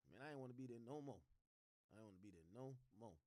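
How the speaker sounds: soft, low, fading reply; a hint of a worn-out defendant; weary. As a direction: let it trail off tired. Quoting defendant's weary answer.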